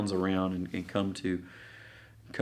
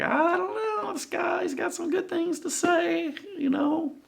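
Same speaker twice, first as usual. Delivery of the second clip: much higher, timid mock excuse; a bashful guard shrugging it off; high apologetic yokel cop; sheepish police; 14 semitones higher than usual. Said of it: "these typical police who are like".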